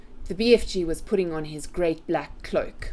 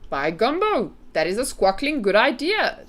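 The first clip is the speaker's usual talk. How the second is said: higher, louder storybook exclamation; pleased giant cheering the idea; delighted giant; 4 semitones higher, 5 dB louder than usual. A narrator's reading. BFG approving Sophie's idea, delighted exclamation.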